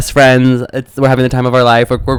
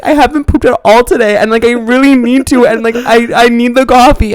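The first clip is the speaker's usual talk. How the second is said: very high imitated wail; mock scream-crying; 12 semitones higher, 4 dB louder than usual. Sister begging dad for coffee, mock scream-crying. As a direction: plead in a hammed-up tearful squeal. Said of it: "she was, like, scream crying".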